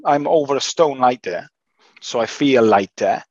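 usual speaking voice

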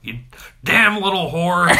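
put-on loud, high, slow cranky old man shout. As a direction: bellow it like a furious old man. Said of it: "My old man voice is terrible".

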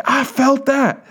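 high, stretched-out amazed exclamation; imitated elated cry. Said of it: "And he's like".

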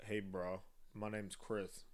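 deep, soft bro introduction; straight guy voice. Acted. Introducing himself on a dating show, low straight guy voice. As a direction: drop the pitch and play a bro. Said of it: "good at a straight person accent".

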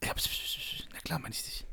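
scharfes, tonloses Verschwörerflüstern; flüstern. Acted. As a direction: heimlich zischend flüstern. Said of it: "müssen flüstern jetzt". (geflüstert)

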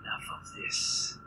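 slow eerie whisper, far off; a slow whispered demon challenge; creature whisper. Acted. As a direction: draw it out as a voice inside his head. Monster demands possession.

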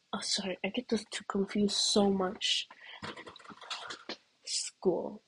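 soft unvoiced whisper. Real, not acted. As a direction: whisper the correction as a joke. Whispered joke correction.

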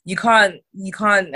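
ordinary talk

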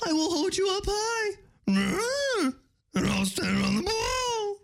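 high, breaking hippie stoner voice; Shaggy voice. Acted. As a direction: whine it like a nervous Shaggy. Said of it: "I haven't done Shaggy in a long time".

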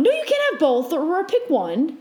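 high, insistent mock scolding, dropping at the end; imitated parental refusal. Parent telling child to pick one, high mock-stern refusal. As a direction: play the parent laying down the rule.

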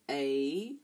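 usual speaking voice

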